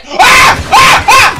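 high terrified screaming; panicked screams. Real, not acted. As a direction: shriek in terror. Attacked, screaming.

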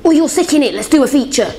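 high, brash imitation of a presumptuous caller; mimicked pushy requester. Artist mocking people who demand features, brash imitation.

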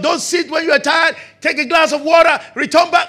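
loud barking drill commands, high; coach shouting orders. Retold or imitated. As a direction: bark it like a strict coach on court.

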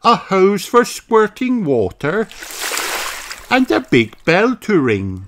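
a cheerful rabbit lady; Miss Rabbit. A narrator's reading. Miss Rabbit greets the children, high chirpy voice. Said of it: "says Miss Rabbit".

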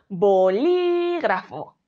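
very slow "bolígrafo", LI drawn out; bo-LÍÍÍ-gra-fo; stress on second syllable. Shown as a demonstration. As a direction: exaggerate and hold LI in "bolígrafo". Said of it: "Marcando en li esa sílaba tónica".

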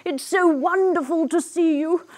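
mock-weepy storybook voice; tearful crocodile. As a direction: play a sorry old crocodile crying. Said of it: "he begins to cry".

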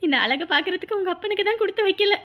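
high, choked-up mother's voice; crying with wistful pride; tearful. Acted. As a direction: speak through tears to your daughter. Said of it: "என்னம்மா அழுதுட்டு இருக்கீங்க".

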